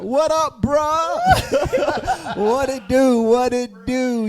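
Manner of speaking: high, loud hyped-up bro voice; hype bro greeting. Pretend. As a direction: shout out the crew like a hype man. Greeting viewers playfully.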